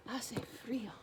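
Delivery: normal talking voice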